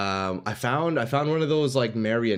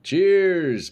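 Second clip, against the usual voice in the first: hearty toast; 8 semitones higher, 6 dB louder than usual. Real, not acted. Raising coffee to chat.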